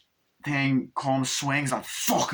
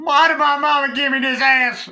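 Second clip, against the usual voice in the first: high, loud mock-diva wail; comic put-on voice; 14 semitones higher, 10 dB louder than usual. Joking to viewers about farting, loud mock whine. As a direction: ham it up like a sassy complaint.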